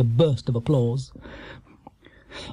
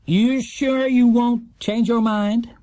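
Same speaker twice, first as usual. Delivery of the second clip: slow, higher, coaxing host voice; smarmy quizmaster; 9 semitones higher, much slower than usual. A narrator's reading. Quizmaster coaxing the bear to change his mind, slow and smarmy. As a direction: draw it out like a smug game-show host.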